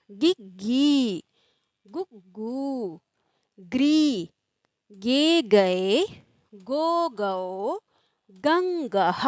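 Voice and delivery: slow, sing-song syllable chant; barakhadi recitation. Shown as a demonstration. Hindi teacher reciting the barakhadi to students, sing-song chant. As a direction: chant each syllable like a class drill.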